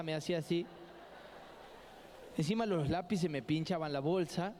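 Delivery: usual speaking voice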